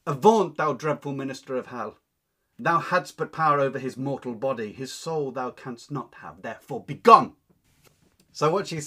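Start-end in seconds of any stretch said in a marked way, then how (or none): 0.1-0.6 loud banishing cry. Acted. Anne banishes the devil, loud commanding cry. 0.6-2.0 loud curse. Acted. Anne cursing Richard. 2.6-7.4 indignant Anne. Acted. Teacher reads Anne cursing Richard.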